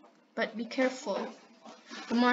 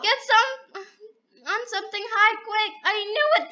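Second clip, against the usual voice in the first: high urgent calling; 8 semitones higher, 4 dB louder than usual. Real, not acted. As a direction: hurry them up, raised pitch. Rushing to escape lava.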